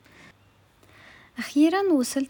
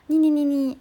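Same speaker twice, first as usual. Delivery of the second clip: louder whiny taunting syllables; mock them like a bratty kid; mocking nyah nyah; 3 semitones higher, 5 dB louder than usual. Mocking the people who call her uptight.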